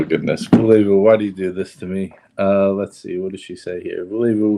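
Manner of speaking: ordinary delivery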